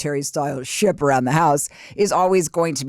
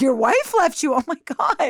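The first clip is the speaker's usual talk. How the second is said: high-pitched, acted disbelief; aghast, voice shooting up; shocked reaction; 9 semitones higher than usual. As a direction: replay your stunned reaction to bad news. Retold reaction to shocking news.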